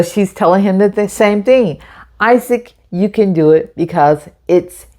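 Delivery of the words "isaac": plain reading voice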